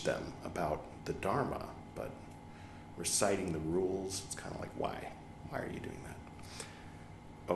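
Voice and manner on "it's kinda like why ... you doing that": whispered aside. Real, not acted. Teacher muttering to the listeners about laymen, whispered. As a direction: drop to a private whisper.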